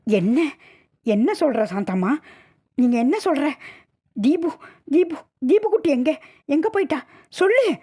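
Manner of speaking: high-pitched panicked demands, climbing to a cry; frantic shouting. Acted. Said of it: "கத்தினாள்". (பதறிக் கத்துதல்)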